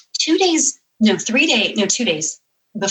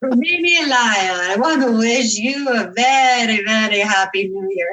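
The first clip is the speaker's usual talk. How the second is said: tipsy; 3 semitones higher than usual. Retold or imitated. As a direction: drag out a hammed-up drunken New Year wish. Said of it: "he sounds kind of tipsy".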